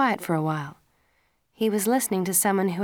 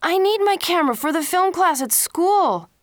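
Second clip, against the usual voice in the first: protesting son; 11 semitones higher, 6 dB louder than usual. A narrator's reading.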